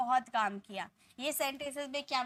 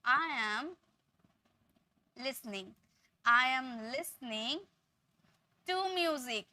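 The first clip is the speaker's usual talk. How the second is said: slow, careful teacher's model English; slow model sentence; much slower than usual. Shown as a demonstration. Teacher slowly models the correct sentence for students. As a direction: spell out each word slowly for learners. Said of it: "आप ये sentences को इस तरह बोलोगे".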